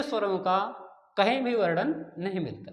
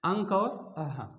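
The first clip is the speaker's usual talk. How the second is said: slow dictation; 3 semitones lower, slower than usual. Shown as a demonstration. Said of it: "teacher repeats terms slowly".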